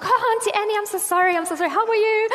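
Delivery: high-pitched, gushing embarrassed apology; mortified cringing. Retold or imitated. Caught-out preacher apologising to Auntie Annie, mortified cringing. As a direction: squeal an over-sweet, cringing apology. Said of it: "I went into full-blown cringe fest".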